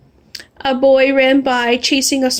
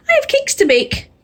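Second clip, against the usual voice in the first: cheerful baker; 12 semitones higher than usual. Acted. Baker voiced high.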